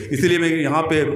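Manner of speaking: usual speaking voice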